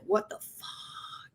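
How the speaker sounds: slow, drawn-out mock whisper; hushed disbelief. Onlookers staring at her, slow whispered imitation of shock.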